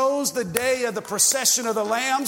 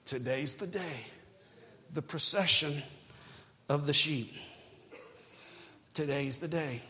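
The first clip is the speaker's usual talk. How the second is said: hushed sermon; 8 semitones lower, 8 dB quieter than usual. Real, not acted. Preacher to congregation, quiet reverent hush. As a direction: draw them in with a hush. Soft slow sermon passage.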